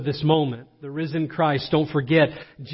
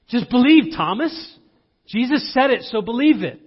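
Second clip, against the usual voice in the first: high, emphatic, exasperated urging; pushy, high-pitched insistence; insistent urging; 7 semitones higher, 3 dB louder than usual. Acted. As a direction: urge a doubter to just believe, pitched up. Voicing impatient disciples urging Thomas.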